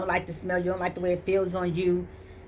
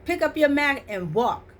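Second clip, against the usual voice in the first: commanding Jesus; 8 semitones higher, 4 dB louder than usual. Retold or imitated. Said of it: "Like Jesus said".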